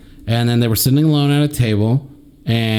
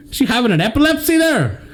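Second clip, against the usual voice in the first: put-on Scottish accent; 11 semitones higher than usual. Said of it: "I don't know if that's Scottish anymore".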